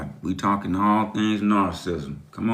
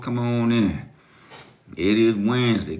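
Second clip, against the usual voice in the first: ordinary delivery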